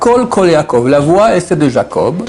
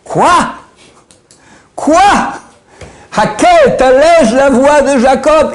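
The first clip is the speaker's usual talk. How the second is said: 1 ungläubig; 7 semitones higher, slower than usual. Real, not acted. Empört über Aufhören des Lernens.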